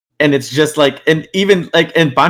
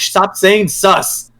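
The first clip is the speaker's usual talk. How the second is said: slow, higher-pitched mock outrage; exasperated retort; 6 semitones higher, much slower than usual. Accused player snaps at his accusers, mock exasperated. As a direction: drag out the exasperated plea.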